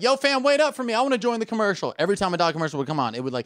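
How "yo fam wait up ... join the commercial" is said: much higher, excited pleading; a dog begging to join the TV; eager dog voice. Retold or imitated. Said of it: "trying to be like".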